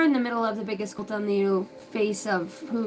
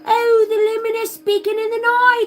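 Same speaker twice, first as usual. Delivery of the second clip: mock preacher; 8 semitones higher, 6 dB louder than usual. Mock preaching about a bride.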